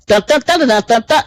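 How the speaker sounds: high, rapid alarm pulses; repeating ringtone-like dun-dun pattern; imitated alarm. Showing listeners his alarm, high imitated ringing. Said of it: "This is his alarm".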